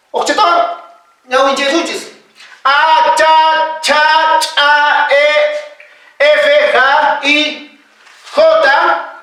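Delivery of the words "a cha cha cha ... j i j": Quechua letters held long and level, run together; alphabet recitation demo. Shown as a demonstration. Teacher recites the alphabet for the class, level drawn-out recitation. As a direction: chant each letter name on an even tone. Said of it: "Se dice con su propio nombre".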